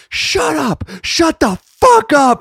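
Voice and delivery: high, loud barked order to shut up; high-pitched hammed-up shout; mock angry yell. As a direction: yell it at the chatty comics in the back. Manager yelling at whispering comics.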